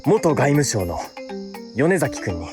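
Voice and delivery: ordinary delivery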